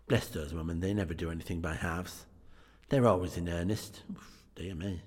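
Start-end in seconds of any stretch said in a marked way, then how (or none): none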